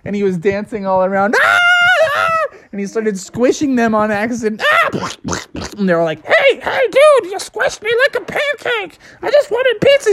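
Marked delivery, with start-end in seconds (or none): 1.4-5.1 very high shrieks while hopping around; shrieks of pain. Acted. Bubby dancing around, stung. 6.3-10.1 much higher, squeaky little-bug complaint; squashed scorpion whining at the pizza man; squished scorpion. Acted.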